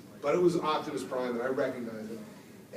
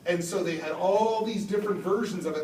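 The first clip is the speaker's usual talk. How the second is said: ordinary talk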